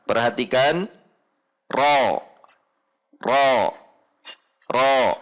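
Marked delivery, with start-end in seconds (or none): 1.7-5.2 heavy "ro" with the back of the tongue raised; thick ro. Shown as a demonstration. Teacher models fathah, a thick, heavy "ro". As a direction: thicken "ro", tongue root lifted. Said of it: "dengan tebal".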